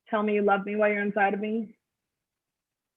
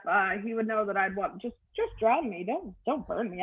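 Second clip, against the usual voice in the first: usual speaking voice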